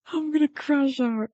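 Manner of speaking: high, distressed wail; crashing out. Real, not acted. Streamer can't get the stream back, openly crashing out. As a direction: let it climb into a high whine.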